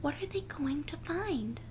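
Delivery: soft whispered question. Real, not acted. Storyteller whispering a question to the children. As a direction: lean in and whisper. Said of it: "whispered question to listeners".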